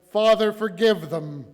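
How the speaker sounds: high, loud appeal to heaven; pleading cry. A narrator's reading. Crucified Jesus prays for his executioners, crying out. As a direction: call out the prayer with force.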